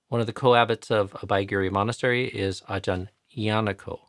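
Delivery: ordinary talk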